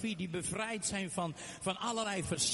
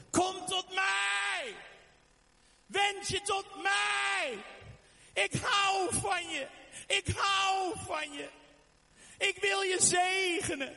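much higher, louder, slow pleading shout; God shouting from heaven; 12 semitones higher, 3 dB louder than usual. Acted. God calling to people from heaven, loud pleading shout. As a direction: cry out from heaven with longing. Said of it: "Hij schreeuwt vanuit de hemel".

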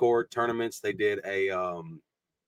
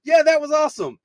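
much higher, louder excited chatter; excited talking to nobody; 10 dB louder than usual. Retold or imitated. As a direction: gush to an empty room. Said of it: "I'm just talking like".

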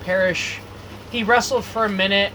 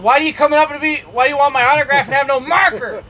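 louder, higher indignant rant; acted heel berating; 5 semitones higher, 5 dB louder, faster than usual. Wrestler playing bad guy to kid with no marker, indignant berating. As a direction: tear into a fan as the villain.

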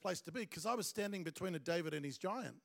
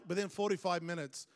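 ordinary delivery

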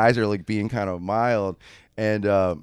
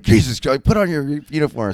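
higher, louder exasperated order; retold impatient command, pitched up; 5 semitones higher, 6 dB louder than usual. Jail staff hurrying a late actor into costume, exasperated.

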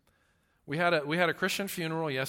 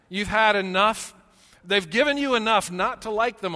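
higher and much louder, preaching it out; preacher rising to full force; loud emphatic; 6 semitones higher, 8 dB louder than usual. Acted. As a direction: hit it hard, building to a climax. Building list of parenting trials.

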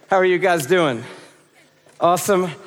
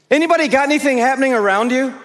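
quick call-out; 6 semitones higher, much faster than usual. Real, not acted. Preacher calling out to crowd.

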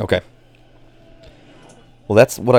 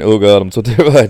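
louder, self-mocking fearful wail; mock terrified; 6 dB louder than usual. Apologizing to the others for not calming them, mock terrified. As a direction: quote your own panic with a laugh. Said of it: "I'm not calming you guys down by saying".

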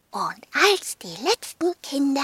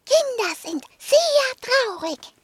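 higher, small dog voice; 11 semitones higher than usual. Acted. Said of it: "sagte Hundling".

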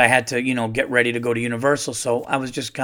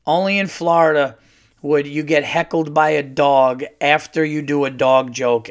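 ordinary talk

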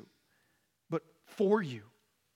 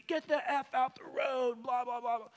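mock angry yell; 8 semitones higher than usual. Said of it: "she's honking at me".

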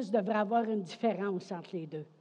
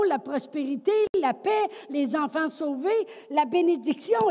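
loud, high, rousing preacher's voice; full-force preaching; 6 semitones higher, 8 dB louder than usual. Real, not acted. Preacher promises the congregation deliverance through praise, full-force preaching. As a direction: build the list of promises into a ringing proclamation.

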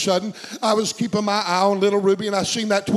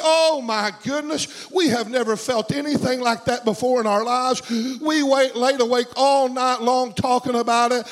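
retold amazed gushing; 3 semitones higher than usual. Preacher voices coworker thrilled by the service, gushing amazement. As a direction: gush as if retelling someone's amazement.

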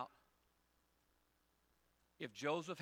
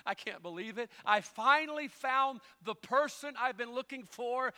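much higher, louder, slowed in delight; excited frog; 11 semitones higher, 13 dB louder, slower than usual. Acted. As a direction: burst out in joyful disbelief. Frog overjoyed, voiced by preacher.

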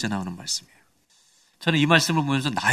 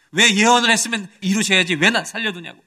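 retold indignant protest, pitched up; higher-pitched, mock-aggrieved demand; argumentative complaint; 6 semitones higher than usual. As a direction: argue back at God as an aggrieved Jonah. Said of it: "막 따지는 거예요".

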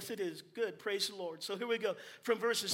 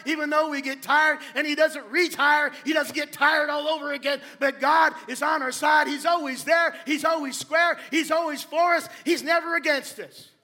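loud, higher, driving sermon lines; fiery preaching; 4 semitones higher, 8 dB louder than usual. Acted. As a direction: preach it out to lift the congregation. Preaching at full force.

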